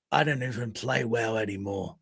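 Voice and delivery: mock-jaded, dropped low in pitch; weary deadpan quote. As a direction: say it low, like he's over it. Quoting a weary Leroy Jenkins.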